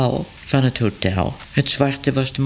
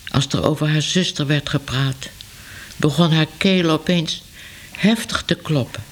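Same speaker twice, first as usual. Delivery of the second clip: ordinary delivery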